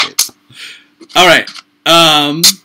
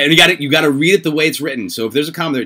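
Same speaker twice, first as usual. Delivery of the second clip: ordinary delivery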